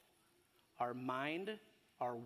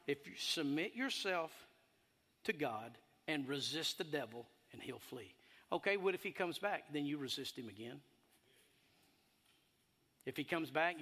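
normal talking voice

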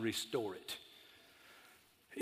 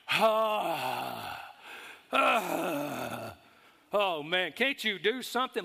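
impatient customers; 2 semitones higher, 3 dB louder, much slower than usual. Retold or imitated. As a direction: mimic grumbling people in line. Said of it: "you're hearing".

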